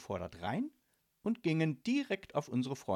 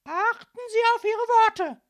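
very high, shouted warning; shrill, menacing policewoman; threatening; 16 dB louder than usual. A narrator's reading. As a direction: warn them off at full volume. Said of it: "drohte nun die hochgewachsene Polizistin".

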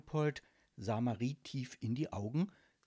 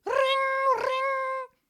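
very high, loud trilled doorbell imitation; shrieking bell sound; ringing doorbell; 10 dB louder than usual. A narrator's reading. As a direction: mimic an urgent bell, held on one note. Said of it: "drückte aufgeregt die Klingel".

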